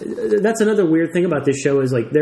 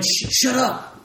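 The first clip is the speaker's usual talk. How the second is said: put-on bratty kid; 11 semitones higher, slower than usual. Kids shut Simon out.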